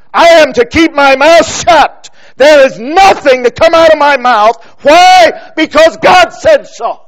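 preacher thunders that God's word suffices; emphatic preaching. Real, not acted. Preacher to congregation, emphatic loud declaration.

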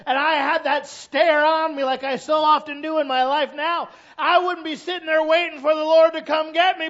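high, loud climbing sermon, slowing at the end; full-force preaching. Acted.

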